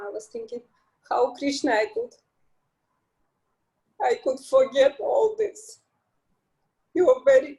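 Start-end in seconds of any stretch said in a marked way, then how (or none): 1.1-7.5 overcome, barely holding it together; emotional. Real, not acted. Devotee telling her story, choked up with emotion. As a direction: voice thick with real emotion. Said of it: "I got emotional".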